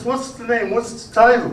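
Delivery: high-pitched, eager bartender questions; eager questioning. Acted. Bartender eagerly asks song's title.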